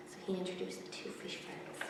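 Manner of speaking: ordinary delivery, nothing marked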